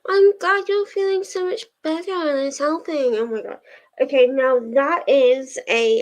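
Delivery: ordinary talk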